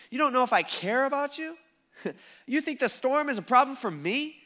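high, disbelieving questions with a laugh between; incredulous challenge. Retold or imitated. Jesus to doubting disciples in the boat, incredulous.

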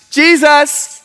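high, loud fanfare shout; mock cheer. Groupies greeting Jesus after the countdown, mock cheering. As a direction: hail the star's big entrance.

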